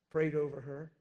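ordinary talk, nothing marked

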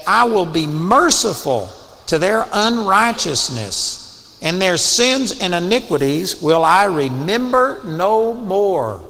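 deliberate, weighty scripture reading; slow, emphatic recital. Shown as a demonstration. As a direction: read it out slowly like words to live by. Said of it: "remind yourself that".

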